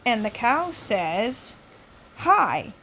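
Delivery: loud, high lively read-aloud; lively story reading. Acted. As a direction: read it out brightly to the room.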